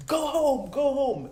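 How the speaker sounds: very high, loud mock tantrum yell; imitated child's scream. Four-year-old screaming at dad in front of everyone, furious high yell. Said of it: "she screams at me in front of everyone".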